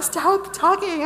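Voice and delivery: high, loud, slow order; exasperated demand. Retold or imitated. Retelling hushing angels so she can focus, exasperated. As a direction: drag out the command, fed up.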